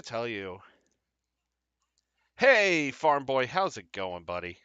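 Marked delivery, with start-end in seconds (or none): 2.4-4.6 loud, higher cheerful hello to the dog; calls out happily to a buddy; greeting a pet. Real, not acted. Host breaks off to greet Farm Boy, louder and higher.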